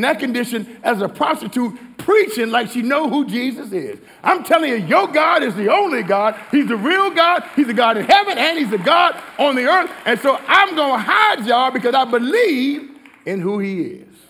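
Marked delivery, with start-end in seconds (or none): 4.2-14.2 loud, high and fervent, as Rahab; bold shouted confession. Acted. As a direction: preach it out at full force. Preacher voicing Rahab's bold confession.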